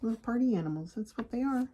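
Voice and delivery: ordinary delivery, nothing marked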